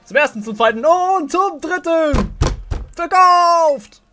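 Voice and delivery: loud, high auctioneer's call. Acted. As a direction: bang the gavel and shout it out. Auctioneer closing the sale.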